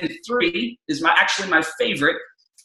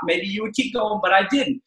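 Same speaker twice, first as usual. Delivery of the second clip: usual speaking voice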